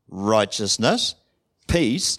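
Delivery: normal talking voice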